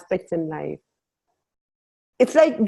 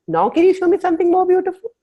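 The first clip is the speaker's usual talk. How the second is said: much higher, louder, thrilled plea for "more beautiful"; eager greedy ask; 12 semitones higher, 6 dB louder, faster than usual. Retold or imitated. Asking the universe to show something more beautiful, excited plea. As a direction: beg the universe like a delighted child.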